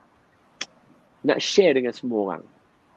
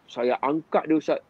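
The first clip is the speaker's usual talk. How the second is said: ordinary delivery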